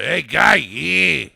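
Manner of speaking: put-on loud Boston accent shout. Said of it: "Get that Boston accent".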